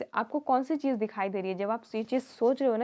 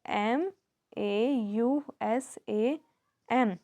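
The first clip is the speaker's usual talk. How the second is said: slow, letter-by-letter spelling; measured classroom spelling; much slower than usual. Shown as a demonstration. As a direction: spell the name slowly, each letter set apart. Teacher spells her name aloud.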